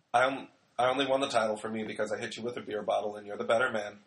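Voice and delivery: low, soft, quick drawn-in grumble; James Storm. Retold or imitated. Wrestler conceding to the man he beat, low and quiet. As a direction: say it low and under your breath. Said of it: "In that kind of t-, voice".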